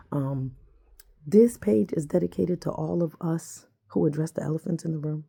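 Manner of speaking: normal talking voice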